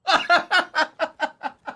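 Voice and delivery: high laughing burst; burst of laughter. Real, not acted. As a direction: laugh at your own joke. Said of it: "That was good".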